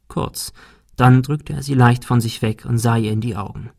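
ordinary delivery, nothing marked

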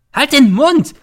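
very high, very loud screech; shrieking. A narrator's reading. Mother shrieking at her son to shut up. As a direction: screech him into silence. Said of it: "kreischt die Mutter".

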